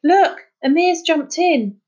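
high, loud startled shout. Acted. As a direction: point it out in alarm. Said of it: "Dougie shouted".

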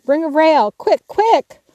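high, loud urgent call; crying out. Acted. Laurie yelling to Jo for a rail, high and loud. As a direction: shout for help across the ice. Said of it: "Laurie's voice cried out".

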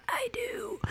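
raspy breathless whisper of a vow; wedding vow that comes out only as a creepy whisper. Retold or imitated. Bride losing her voice whispers her vow, creepy whisper. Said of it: "a creepy whisper".

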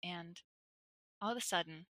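quiet, creeping scary-story delivery; hushed suspense. Retold or imitated. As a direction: build dread quietly, phrase by phrase. Builds suspense before the scare.

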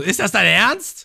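high, loud, slow outraged yell; mock shout. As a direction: yell it in disbelief as Lysop. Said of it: "Und Lysop schreit so". (hoher, lauter, empörter Schrei)

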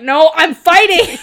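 loud, slow, defiant shout, laughing through it; angry Arthur. Retold or imitated. Arthur refusing Lancelot's offer, angry shout. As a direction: insist on the fight, stubborn and loud. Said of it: "This is a real angry Arthur".

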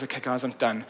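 low, soft, spent sigh of a line; exhausted. Retold or imitated. Jesus telling the disciples he's finished, exhausted and soft. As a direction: let the tiredness empty the words. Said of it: "almost fragile and exhausted".

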